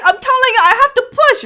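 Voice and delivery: high, emphatic demand; retold desperate warning; urgent insistence.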